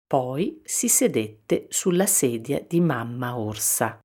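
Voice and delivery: ordinary delivery, nothing marked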